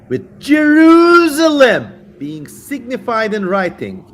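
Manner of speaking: high, slow, shouted demon cry. Acted. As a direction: bellow it like a tormented demon. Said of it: "I'm just getting into character now".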